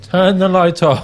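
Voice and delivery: loud, fed-up command. Acted. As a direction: raise it to a near shout. Alien repeats the order louder.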